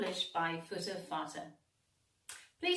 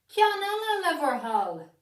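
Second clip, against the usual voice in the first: higher, louder eager request; crowd calling; 9 semitones higher, 9 dB louder than usual. A narrator's reading. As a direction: call out for one more. Crowd asks for more.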